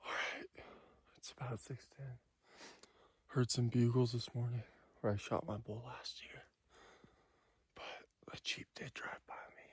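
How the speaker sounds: hushed hunting whisper. Real, not acted. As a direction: whisper so the elk can't hear. Hunter whispering near elk.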